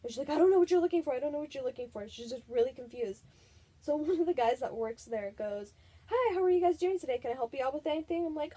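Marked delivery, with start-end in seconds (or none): 0.3-1.1 louder, higher, exasperated mom; flustered mom. Retold or imitated. 6.1-8.3 higher, louder, quick shop-clerk greeting; cheery clerk. Retold or imitated. Store clerk greeting customers.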